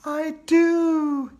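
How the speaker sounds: high, loud ghostly answer; spooky bride agreeing. Acted. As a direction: say it with eerie glee. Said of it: "Andrea said".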